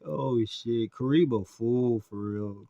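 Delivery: ordinary talk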